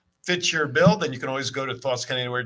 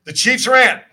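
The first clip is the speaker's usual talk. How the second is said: announcer build-up; 2 semitones higher, 6 dB louder than usual. Real, not acted. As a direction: hype the rant like a ring announcer. Announcing the segment.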